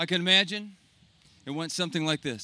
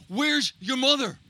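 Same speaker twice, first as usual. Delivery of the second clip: desperate demand; 5 semitones higher, 3 dB louder, slower than usual. Acted. Father shaking son, desperate.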